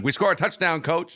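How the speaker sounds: high, cocky mocking reply. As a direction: needle the coach with a smug grin. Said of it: "he was just kinda mocking him".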